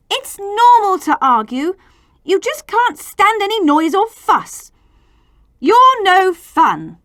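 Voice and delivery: high, loud, cross protest; children angrily scolding the grown-ups; angry children. Acted. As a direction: tell the grown-ups off, fed up. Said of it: "the children were angry".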